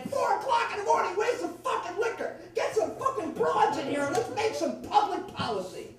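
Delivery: high-pitched, rowdy demand; rowdy party call. Acted. Kennedys wanting liquor at four a.m., high rowdy call. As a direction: holler it like a partying rock star.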